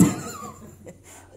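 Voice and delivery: high burst of laughter. Real, not acted. Preacher laughs at his own monkey story, high laugh. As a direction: laugh out at the joke.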